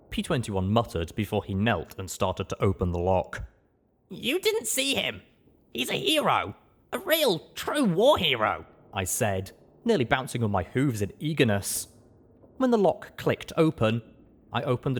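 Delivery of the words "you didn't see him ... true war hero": put-on excited young mare. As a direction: gush like an excited fan. Blackjack bouncing in eagerness.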